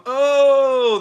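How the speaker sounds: high, loud put-on "Oh" like a man in pain; mock pained groan. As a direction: groan "Oh" as if just out of surgery. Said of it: "when he's sitting there going".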